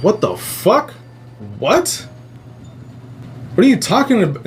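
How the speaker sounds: high, rising shocked what; shocked disbelief. Retold or imitated. Dreamer confronting creature, shocked and high. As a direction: blurt it out in alarmed confusion.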